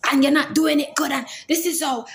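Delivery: nagging correction. Acted. Woman correcting her man over a chore, nagging loudly.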